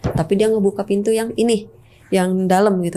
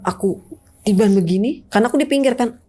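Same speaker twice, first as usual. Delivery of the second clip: ordinary delivery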